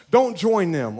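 usual speaking voice

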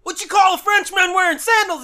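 loud, high screamed joke; screaming. Pretend. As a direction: yell it at a sleeping kid. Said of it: "Why are you screaming".

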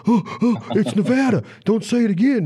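high-pitched, hammed-up outrage; mock irate. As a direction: imitate a worked-up guy huffing and protesting. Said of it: "they get all irate and all heated".

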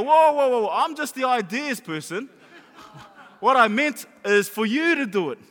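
high, loud mock backpedalling; alarmed protest. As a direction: imitate someone hastily protesting. Mimics people backing off in alarm.

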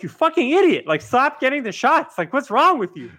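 high, loud yelling at an idiot; exasperated yell. Retold or imitated. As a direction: shout it at the screen in disbelief. Yelling at the screen.

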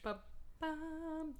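soft whispered drum beat; whispered ba-bum. Pretend. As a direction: breathe it out like a spooky sting. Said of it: "spooky sound effect".

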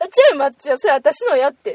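loud, firm Kansai protest; loud demo of a protest. Shown as a demonstration. Telling a stranger to hand it back, loud and firm. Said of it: "って言ったらいい".

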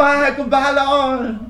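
high mock farewell. Farewell to departing spirit.

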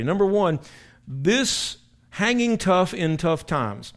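emphatic sermon heading, drawn out; preaching. Acted. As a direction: hammer home the first point. Preaching the first point.

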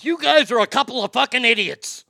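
furious boss yell. Retold or imitated. The GM scolding the radio hosts in his office, an imitated furious yell. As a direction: blast it like the boss chewing them out. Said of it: "So he goes".

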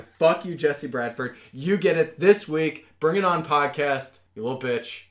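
loud, playful taunting; mock trash talk. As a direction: call him out like a rival.

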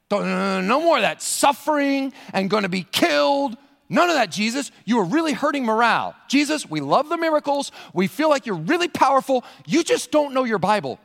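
high-pitched, hammed-up scolding; exasperated, insistent telling-off; Peter rebuking. Peter lecturing Jesus on morale, high and scolding. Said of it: "began to rebuke him".

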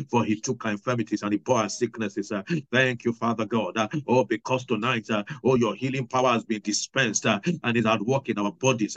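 normal talking voice

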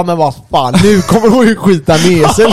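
exasperated inner voice, played for laughs; acted dismay. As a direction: blurt out a comic oh-no thought. Acted dismayed thought, startling a woman.

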